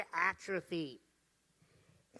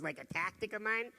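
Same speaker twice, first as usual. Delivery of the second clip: ordinary talk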